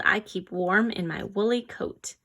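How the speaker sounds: normal talking voice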